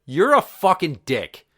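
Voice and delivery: retold outburst, stretched out and punched; losing his cool. Fed-up dad confronting a stranger, angry and loud. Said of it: "I just lost my cool".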